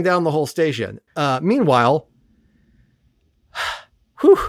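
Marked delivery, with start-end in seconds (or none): 4.2-4.4 sigh. Real, not acted. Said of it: "sigh before moving on".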